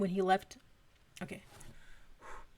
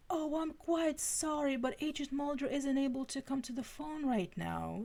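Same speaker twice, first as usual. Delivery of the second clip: put-on British accent; 7 semitones higher than usual. A narrator's reading. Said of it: "a male voice with a British accent".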